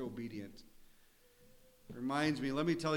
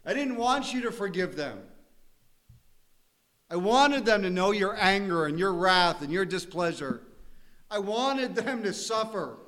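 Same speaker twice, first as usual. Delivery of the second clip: high, loud, bitter complaint to God; Jonah's loud, bitter whine; whining Jonah; 8 semitones higher, 7 dB louder than usual. Retold or imitated. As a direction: plead like a sulking prophet. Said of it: "Sometimes we're like Jonah".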